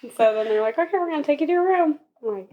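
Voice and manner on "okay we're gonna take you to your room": higher, quick singsong voice; high-pitched, brisk retold line; retold staff announcement. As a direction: imitate staff rattling off the next step. Retelling staff's cheerful instruction.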